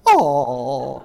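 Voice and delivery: exaggerated, drawn-out toddler's "oh"; imitating cooing granddaughter. Grandparent imitating Owen learning to be a woman, loud mock coo. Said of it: "She's already learned to be a woman".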